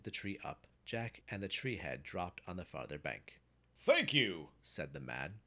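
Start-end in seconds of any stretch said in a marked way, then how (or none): 3.8-4.5 loud, raised-pitch thank-you; booming gratitude to a helper; hearty thanks. A narrator's reading. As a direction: call out your thanks heartily. Man thanks Jack for help.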